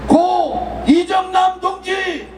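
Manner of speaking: slow, drawn-out call of names; solemn roll call. Real, not acted. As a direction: call each name slowly for the crowd to repeat. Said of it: "제가 선창할 테니까 따라해 주시기 바랍니다". (느리게 부르는 추모 호명)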